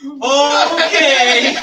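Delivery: high, loud startled "Okay"; a stunned laughing okay; freaked-out okay. Retold or imitated. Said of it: "And I'm like".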